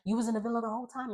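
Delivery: high, rapid disbelief; shocked question. Retold or imitated. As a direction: rattle it off in amazement. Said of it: "Alondria, she was just like".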